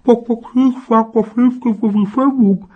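high, loud, garbled mumble; ice in mouth. Acted. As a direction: talk through a mouthful of ice. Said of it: "weil sie einen berühmter generalsförmigen Eiswürfel im Mund hatte".

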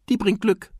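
put-on high child's voice; little boy fish. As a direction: answer Dad in a small high-pitched voice. Child Nemo answers his father. (hohe Kinderstimme)

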